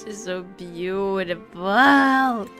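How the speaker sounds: slow, drawn-out swoon swelling on the last word; adoring gush. Real, not acted. Streamer swooning over a beautiful game character, adoring. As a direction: stretch it out in adoration.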